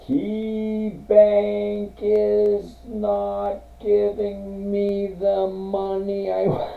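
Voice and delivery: high monotone, every word stretched out; exaggeratedly slow. Shown as a demonstration. Host repeating the verdict to a viewer, mock slow. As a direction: drag each word out on one high note. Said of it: "Repeat that again slowly".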